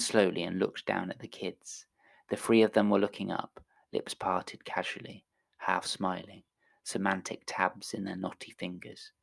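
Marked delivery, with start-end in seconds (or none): none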